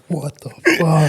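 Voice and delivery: high, drawn-out disbelief; mock disbelief. Friend imagines waking up covered in spew, mock disbelief.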